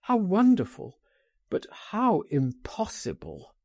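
high exclamation that falls back; acted cry of amazement; crying out in wonder. A narrator's reading. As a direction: exclaim in wonder, then sink at the impossibility. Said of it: "he cried".

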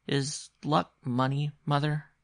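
put-on high, slow, tentative boy; rather timidly. A narrator's reading. Boy asks mother about luck, rather timidly. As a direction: ask carefully as a timid child. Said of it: "he asked rather timidly".

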